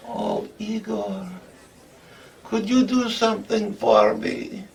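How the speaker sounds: put-on high-pitched, coaxing girlfriend voice; Igret. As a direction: ask a favour in a put-on high voice. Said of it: "She say".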